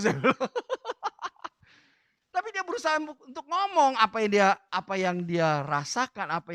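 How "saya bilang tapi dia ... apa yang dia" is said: usual speaking voice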